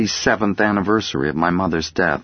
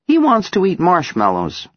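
put-on high, louder child voice; little girl voice; 12 semitones higher, 4 dB louder than usual. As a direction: speak for the teddy like a small girl. Child speaks for teddy.

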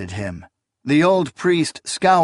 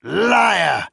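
put-on furious old priest; 5 semitones higher, much slower than usual. A narrator's reading. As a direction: denounce her in fury. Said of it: "he cried".

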